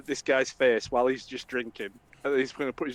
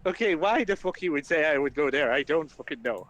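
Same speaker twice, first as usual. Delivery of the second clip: usual speaking voice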